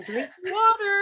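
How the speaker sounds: very high, slow mock order; high-pitched joke command, drawn out; playful nagging. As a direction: squeal it teasingly through laughter. Mocking herself as water-pushing teacher.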